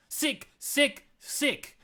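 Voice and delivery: high-pitched repeated roar; enraged, out-of-control yells; furious shouting. A narrator's reading. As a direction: hurl each word out separately. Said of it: "As Arthur shouted these words".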